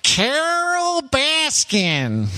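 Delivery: high, very slow drawn-out twang; Joe Exotic impression. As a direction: stretch the name out in a high twang. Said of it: "Joe Exotic impression".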